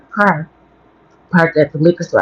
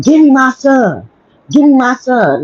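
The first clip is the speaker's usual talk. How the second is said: demanding man; 8 semitones higher than usual. Retold or imitated. Man in the car demanding her baby, high insistent mock voice. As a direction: repeat it like a stranger insisting. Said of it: "he was like".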